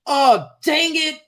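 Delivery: high, drawn-out put-on kid voice; twelve-year-old. Twelve-year-old coming on game chat, high mock-child voice. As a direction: imitate a kid's slow, squeaky frustration. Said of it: "this fucking twelve-year-old that'll come on".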